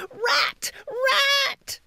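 very high, loud shrieking insult; boy yelling at his cousin as they fight; bellowed insult. A narrator's reading. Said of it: "bellowed out aloud".

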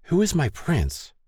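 high, lost searching question; dazed inner question. Acted. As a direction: ask it like a dazed mind. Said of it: "her mushy brain questioned".

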